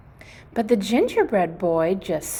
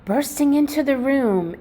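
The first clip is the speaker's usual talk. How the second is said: plain reading voice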